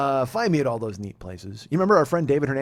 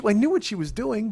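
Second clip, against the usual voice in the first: ordinary talk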